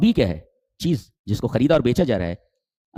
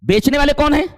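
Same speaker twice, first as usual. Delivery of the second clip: high, loud call to the hall; loud rhetorical question; 6 dB louder than usual. Acted. Preacher asking the congregation who the sellers are, loud. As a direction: fling the question out to the crowd.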